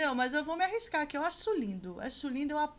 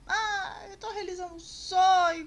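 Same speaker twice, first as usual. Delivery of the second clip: imitating a teary gush, higher and louder; hammed-up sob over a dream come true; mock crying; 5 semitones higher, 5 dB louder than usual. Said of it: "já chegou chorando".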